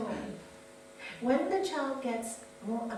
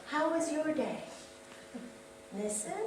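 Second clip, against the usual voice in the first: usual speaking voice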